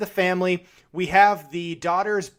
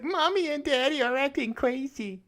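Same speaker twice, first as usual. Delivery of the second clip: put-on high, soft little-boy voice; 9 semitones higher, 6 dB quieter than usual. Actor imagined as a little boy hiding under the bed, childlike. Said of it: "should have played the little boy".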